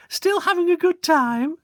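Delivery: very high, chirpy mum; sing-song hostess; mother's voice. Acted. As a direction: pop in cheerily. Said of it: "asked Stuart's mother".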